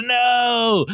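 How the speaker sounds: high, mock-despairing shout; hammed-up cry of protest; mock crying wail. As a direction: wail it like a crying meme character. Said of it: "we got some crying Wojaks".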